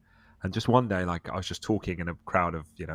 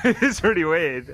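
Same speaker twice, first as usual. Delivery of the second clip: slow, high, loud words through laughter; can barely get it out for laughing; 10 semitones higher, 8 dB louder, much slower than usual. Real, not acted. Still laughing at the story.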